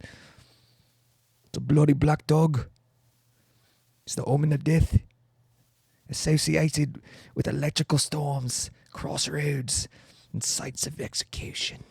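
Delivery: spooky storyteller in a fake English accent; ominous English accent. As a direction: draw out a hammed-up English accent, eerily. English voice telling of omen.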